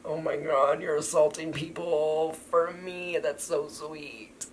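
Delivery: high, drawn-out gushing; mock swooning. Reader reacting to being defended with punches, mock swooning. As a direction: gush slowly in a high put-on voice.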